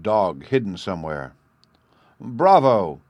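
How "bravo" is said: loud cry of congratulation; cried out. A narrator's reading. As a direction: cheer him with a hearty bravo. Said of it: "he cried".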